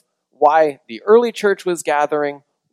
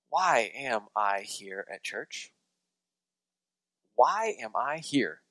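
soft, slow searching question; hushed, unhurried self-questioning; reflective question; 3 semitones lower, 12 dB quieter, slower than usual. Shown as a demonstration. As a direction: ask it quietly, as if to yourself. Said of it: "I'm asking that both kind of sarcastically".

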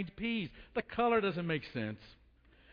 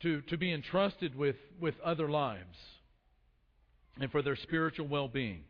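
lower, reverent praying voice; prayer; 5 semitones lower than usual. Acted. Pastor praying to the Father for the congregation, lower prayerful voice. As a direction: pray earnestly, measured and subdued.

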